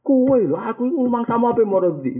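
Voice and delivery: high-pitched mock rant; a hammed-up fuming relative; mock angry relative. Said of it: "Ngamuk mer".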